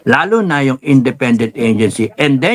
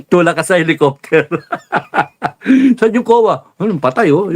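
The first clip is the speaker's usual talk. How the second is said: laughing; 5 semitones higher than usual. Real, not acted. Laughing joke about killing.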